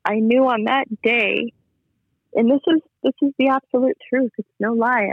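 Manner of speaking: holding it together as the feeling wells up; emotional, near tears. Real, not acted. Recalling the day she nearly gave up, on the edge of tears. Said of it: "sorry, get emotional".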